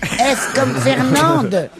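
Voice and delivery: slow emphatic repeat. Shown as a demonstration. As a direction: repeat "F comme Fernande" slowly, stressing each word.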